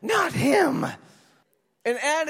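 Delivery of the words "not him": high, loud appalled cry; shocked outcry. Retold or imitated. Churchgoers react to hearing the villain's name, loud shocked outcry. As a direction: recoil in horror at the name. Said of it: "Other people went".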